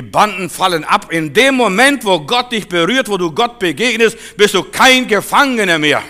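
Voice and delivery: loud, high, rising sermon climax; full-force revival preaching. Acted. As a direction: preach it out with fire. Preaching builds up.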